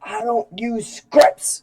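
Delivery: high, loud, drawn-out mock protest; mock outburst. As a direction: holler it slowly in mock outrage. Mock outburst in banter about scripts.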